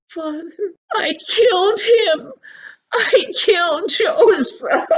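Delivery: high, loud, crying voice breaking; a child's wailing confession, slowing at the end; sobbing confession. Acted. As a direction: blurt the guilt out through tears. Boy confesses to his father.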